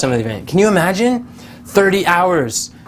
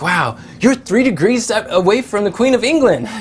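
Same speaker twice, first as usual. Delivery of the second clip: higher, impressed quoted wow; retelling her amazed delight; excited; 6 semitones higher than usual. Retold or imitated. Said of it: "She was all excited about that".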